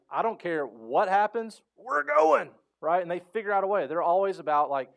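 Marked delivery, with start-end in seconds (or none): 0.1-2.5 slow start rising to a high loud shout; the driver type forcing the way forward, mock shout; pushy driver. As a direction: bulldoze ahead, ending in a high yell. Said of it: "that they're like".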